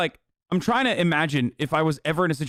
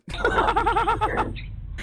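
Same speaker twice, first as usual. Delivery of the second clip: high-pitched laugh. Real, not acted. Laughs at suspect.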